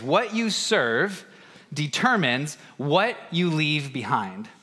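slow, weighty delivery of the key phrase; deliberate slow proclamation; slow emphatic phrase. Shown as a demonstration. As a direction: lay it down slowly, word by word. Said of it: "this phrase".

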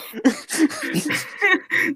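squeaky high laugh; a sudden high-pitched burst of laughter. Real, not acted. Laughs at own story.

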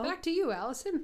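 high, bright-pitched pretend anchor toss; playful TV hand-off; mock broadcast hand-off. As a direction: play a presenter throwing to a colleague.

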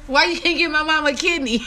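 high, fast laughing imitation; mock the plea while laughing; laughing quote. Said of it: "And then he was like".

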